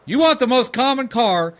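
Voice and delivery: a dad amazed, loud and high; incredulous exclaiming. Real, not acted. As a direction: marvel out loud at how ordinary it is. Dad amazed at simple request.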